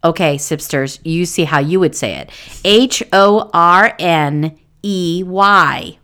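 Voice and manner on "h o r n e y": slow, letter-by-letter spelling; very slow, separated letters. Shown as a demonstration. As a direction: spell it out deliberately, one letter at a time. Spells the name out for listeners.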